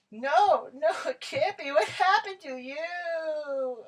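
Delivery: mock wailing. Karen horrified at baby.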